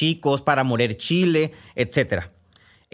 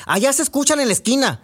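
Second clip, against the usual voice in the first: put-on much higher, whiny kid complaint; complaining kids; 10 semitones higher than usual. Kids in bed griping about the noisy crickets, whiny. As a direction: gripe about the crickets like a child. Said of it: "a quejarse de ellos".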